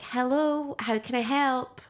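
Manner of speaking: polite call-centre greeting voice; put-on phone voice. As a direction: answer the phone in your protective work voice. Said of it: "Is this the voice that you would put on?".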